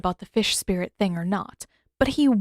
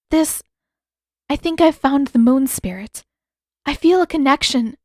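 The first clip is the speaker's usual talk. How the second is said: put-on higher young woman's voice, pleading and searching; young princess voice pressing for more; Yue, urgent; 6 semitones higher, 6 dB louder than usual. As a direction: reach up high, anxious to find a way.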